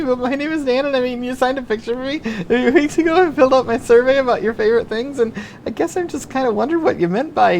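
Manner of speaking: lost my cool. Retold or imitated. Nervous teen rambling to his comedy hero, flustered. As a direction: babble like a starstruck fanboy. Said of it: "I lost my cool".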